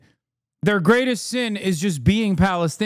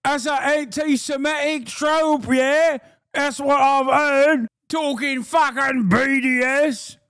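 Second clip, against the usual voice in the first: ranting, higher angry bloke voice scolding Gary; mock gammon; 5 semitones higher than usual. Streamer mocking an angry gammon berating Gary, hammed-up rant. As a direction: lampoon an outraged pub bloke, yelling at moments. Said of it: "I'm turning into Australian".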